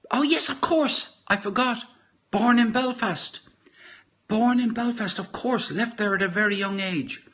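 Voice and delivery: suddenly remembering, high and bright; sudden recollection. Real, not acted. Realising he forgot a fact.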